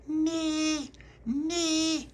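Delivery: put-on very high baby squeal; baby's letterbox shout. A narrator's reading. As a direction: call out in a tiny, piercing baby voice. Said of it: "she shouted through the letterbox".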